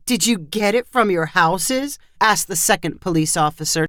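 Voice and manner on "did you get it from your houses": ordinary delivery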